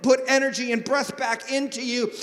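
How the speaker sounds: ordinary talk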